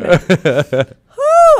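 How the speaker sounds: ordinary talk, nothing marked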